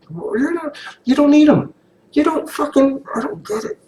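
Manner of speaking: squeaky parody of a TV character; high mocking impression of the man. Mock voice of character.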